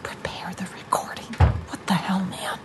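baffled near-whisper; very soft, secretive muttering; hushed conferring. Acted. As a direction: whisper it while she's out of the room. Siblings confer once she leaves.

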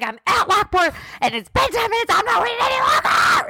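very high, fed-up mock outburst; hammed-up exasperation. Fed-up caller stuck waiting at Lockport, mock exasperated outburst. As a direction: replay the tantrum, pitch shooting way up.

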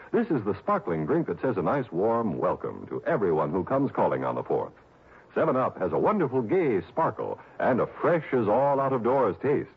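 normal talking voice

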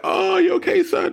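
squeaky high, overly sweet pampering voice; mock a doting parent fussing over a fallen child; mock coddling parent. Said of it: "Y'all be like".

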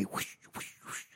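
soft airy whooshes, evenly spaced; hushed swish of air, repeated; whispered whooshing. Retold or imitated. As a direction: mimic something spinning through the air. Imitates lever still flying.

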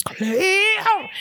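high, drawn-out cry of a name; hammed-up high-pitched holler; mock scream. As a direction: call out wildly without full volume. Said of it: "screaming Claire's name".

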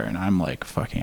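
ordinary delivery

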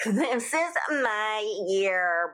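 slow, drawn-out Valley Girl mock; mocking them as Valley Girls. Mocking the Kardashians in a slow Valley Girl voice. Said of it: "in the Valley Girl voice".

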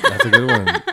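very high, squealing through a laugh; laughing praise. Real, not acted. Praising a friend's joke, squealing with laughter. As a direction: squeal it while cracking up.

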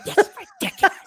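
very soft, whispered complaint; imitated urgent whisper. Wife urging husband to leave, urgent whisper.

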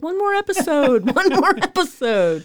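loud, high mock begging; playfully insistent, with a laugh; hooked binge-watcher. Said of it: "I'm like".

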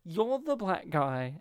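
usual speaking voice

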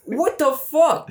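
high, slow, drawn-out disbelief; incredulous. Real, not acted. Retelling a twelve-year-old pimp, stunned high what the fuck. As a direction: stretch it out in pure disbelief. Reacting to the kid story.

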